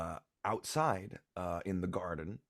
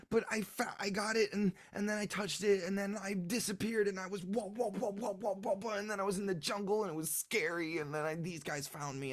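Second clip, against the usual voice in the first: put-on high little-boy voice, rambling without a breath; Leuven; 11 semitones higher, faster than usual. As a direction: babble out an adventure like an excited child. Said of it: "And he says".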